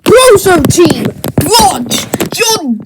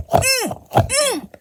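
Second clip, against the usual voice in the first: high puppet hum; 7 semitones higher, 10 dB quieter than usual. Acted. Puppet answers with hums.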